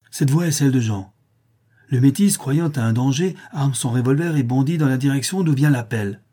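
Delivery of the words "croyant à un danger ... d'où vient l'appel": ordinary delivery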